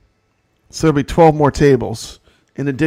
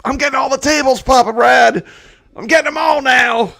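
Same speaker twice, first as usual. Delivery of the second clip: higher, louder eager shout; excited shout; 9 semitones higher, 8 dB louder than usual. Retold or imitated. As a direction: shout it out, thrilled. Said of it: "you're like".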